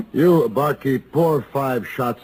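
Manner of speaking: slow, loud call across the room; barked order. Acted. Gunfighter calls the barkeep across the saloon, loud and slow. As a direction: summon the barkeep with authority.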